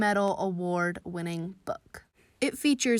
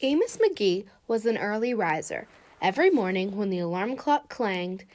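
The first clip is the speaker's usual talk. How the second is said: gentle storybook narration; reading to children. A narrator's reading. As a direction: read it like a bedtime story. Reads picture book aloud.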